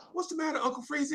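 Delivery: high, soft, fast sympathetic lady; kind teacher voice. Retold or imitated. Female teachers comforting a crying little boy, high soft voice.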